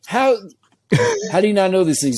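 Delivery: high-pitched mock interrogation; incredulous wife. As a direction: grill him like an exasperated wife. Wife grilling husband about his friend.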